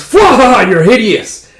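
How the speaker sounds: loud, high mocking laugh into words; hammed-up cruel taunt; gloating villain. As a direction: jeer with a villain's cackle. Villain mocks the unveiled lady.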